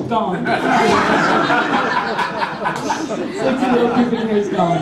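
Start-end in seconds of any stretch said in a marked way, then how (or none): none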